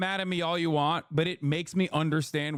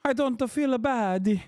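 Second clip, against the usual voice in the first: mock Italian woman; 8 semitones higher than usual. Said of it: "Remember how they said".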